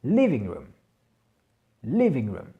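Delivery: living room with LIV- stressed and room lighter; accent sur la première syllabe. Shown as a demonstration. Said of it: "mais est bien moins accentué".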